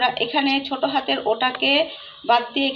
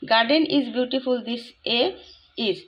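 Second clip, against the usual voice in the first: slow dictation; much slower than usual. Shown as a demonstration. Reads jumbled sentence aloud.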